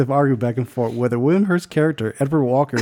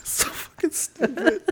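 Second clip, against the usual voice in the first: breathless laugh; 6 dB quieter, slower than usual. Real, not acted. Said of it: "cracking up at friend".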